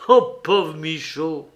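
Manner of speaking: lent et doux, un peu cajoleur; amadoue-le en traînant les mots; ton caressant. A narrator's reading. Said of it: "d'un ton caressant". (ton caressant, lent, pour l'amadouer)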